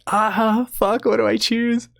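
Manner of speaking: high, strained indecision; hammed-up agonizing over a choice; mock panic. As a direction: squeal it in comic desperation. Retold agonized dilemma.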